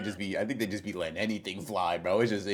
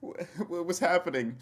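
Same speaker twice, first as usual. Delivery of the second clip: mock anguish; 9 semitones higher, slower than usual. Hammed-up stomach pain.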